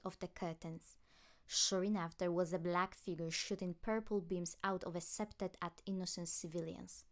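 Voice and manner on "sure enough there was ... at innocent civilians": ordinary delivery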